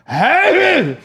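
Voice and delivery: high hammed-up cheer; bursting out like a clown; mock clown cheer. Clown covering a silence: high mock cheer.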